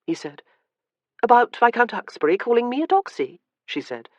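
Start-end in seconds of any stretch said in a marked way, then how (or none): 1.2-3.4 lightly voiced young lady; a touch higher, wry young woman. A narrator's reading.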